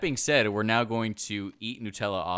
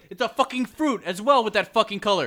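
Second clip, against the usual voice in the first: loud, high-pitched mock amazement; mock excited discovery; 12 semitones higher, 12 dB louder than usual. Co-host acting out whoever named the color orange, loud mock excitement. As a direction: play it like a thrilled inventor, voice raised.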